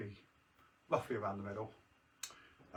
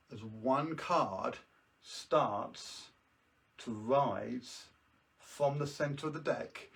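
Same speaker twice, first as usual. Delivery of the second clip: slow, drawn-out magician patter; slow mystical; much slower than usual. Acted. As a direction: draw out the suspense.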